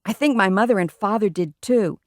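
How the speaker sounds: ordinary delivery, nothing marked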